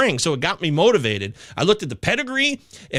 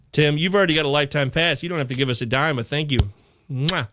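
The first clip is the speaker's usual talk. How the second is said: ordinary delivery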